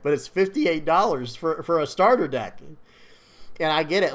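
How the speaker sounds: stifling a laugh while talking; trying hard not to laugh. Real, not acted. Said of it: "I'm trying not to laugh".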